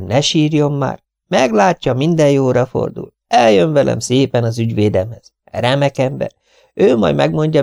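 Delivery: high, fluttery spinster voice; fussy Miss Lawson. Acted. Miss Lawson offering soup to her guest, high and fussy. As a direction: voice her like a fussing old maid.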